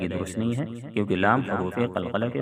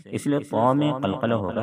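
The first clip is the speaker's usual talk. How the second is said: ordinary delivery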